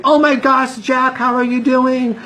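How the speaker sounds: high, gushing friend voice. Retold or imitated. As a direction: play an old friend thrilled to see him. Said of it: "they look at me and they say".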